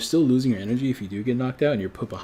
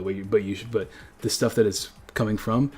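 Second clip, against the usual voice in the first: usual speaking voice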